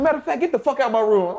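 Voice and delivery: fed-up coach. Retold or imitated. Coach throwing him out.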